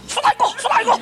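mock teacher shout. Teacher comes back and demands the culprit, high mock yell. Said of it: "he kept shouting".

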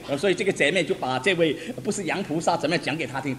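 normal talking voice

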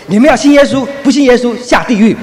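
loud, high shout on the bus; street preacher's shout. Retold or imitated. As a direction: yell it out to wake the whole bus. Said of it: "再说第三次". (高声大喊)

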